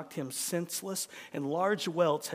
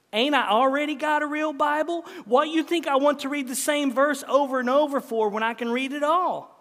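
high, loud indignant retort; raised pitch, exasperated country wife; scolding wife; 8 semitones higher, 5 dB louder than usual. Acted. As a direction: play the wife scoffing at his idea. Wife retorts in story reading.

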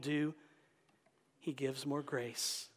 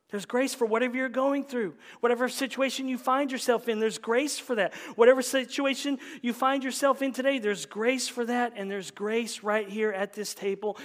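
raised, insistent preacher's voice; fervent preaching; 6 semitones higher, 7 dB louder than usual. Real, not acted. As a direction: press the assurance on the congregation. Preaches at full force.

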